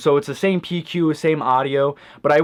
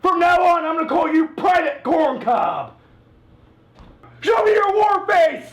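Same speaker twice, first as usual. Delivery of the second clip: drill sergeant; 13 semitones higher than usual. Acted.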